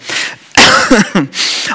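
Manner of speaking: rough real cough; coughing fit. Real, not acted. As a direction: cough mid-sentence. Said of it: "ich hab einen Husten".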